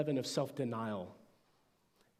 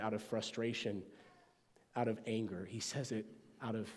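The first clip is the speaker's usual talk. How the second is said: ordinary talk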